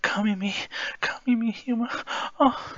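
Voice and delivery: high, breathy pleading whisper; breathy passionate whisper. Acted.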